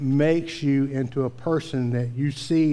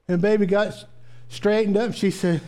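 ordinary talk, nothing marked

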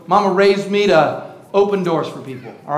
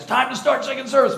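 quick reminder; 4 semitones higher, 5 dB quieter, faster than usual. Retold or imitated. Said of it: "Dinah's running back and says".

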